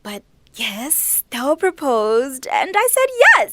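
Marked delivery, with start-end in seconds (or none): 1.3-3.5 higher, louder and slow, bursting with the news; excited announcement. Acted. Neo to her aunt, revealing the engagement, excited. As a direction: let the big news burst out, drawn out.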